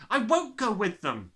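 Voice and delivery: put-on high, loud, defiant child; a girl shouting she won't go; sudden shout. A narrator's reading. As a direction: burst out in refusal. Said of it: "Matilda shouted suddenly".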